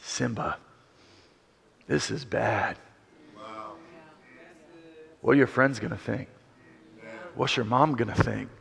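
low, soft menacing whisper-like voice; sly villain Scar. Retold or imitated. Scar crouches beside Simba, low and menacing. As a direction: lean in and plant the doubt. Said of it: "An enemy ... goes".

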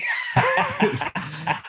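squealing laugh way up high; high laughing shriek. Real, not acted. Big laugh.

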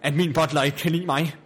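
ordinary delivery

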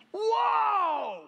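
high, drawn-out shout of amazement; whooped whoa. Real, not acted. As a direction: let out a long, high holler. Preacher exclaims over his point.

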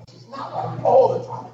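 high-pitched, drawn-out objection; comic exasperated protest. Acted. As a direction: object loudly with a slow, high squeal. Acted protest about wife being right.

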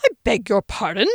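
affronted, questioning disbelief; taken aback. A narrator's reading. Boss shocked at the effrontery, taken aback. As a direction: ask as if insulted. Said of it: "Taken aback at this effrontery".

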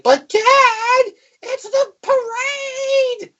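hammed-up child wailing for his dad; whiny kid voice. Kid begging his dad to stay for the parade, high whine. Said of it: "the wife and the kid are like".

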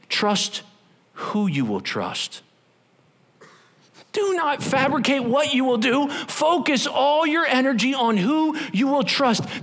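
usual speaking voice

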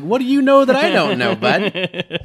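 high, loud incredulous demand; mock alarm. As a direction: cry out in exaggerated disbelief. Acted alarm at friend's remark.

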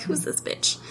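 mock guards, whispered; whispered guard quote. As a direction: breathe it out like a leering guard. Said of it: "They're like".

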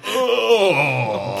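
loud drawn-out cry of delight; loud moan. Retold or imitated. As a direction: wail it out. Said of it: "they made me go".

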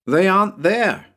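put-on high child's call upward; little boy calling. Tommy reports up to the roof, high boy's call. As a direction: pipe it up high to someone above.

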